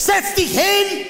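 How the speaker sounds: high German barked orders; German scolding. Retold or imitated. German prostitute snaps at the timid doctor, barking. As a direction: bark it like a stern German madam.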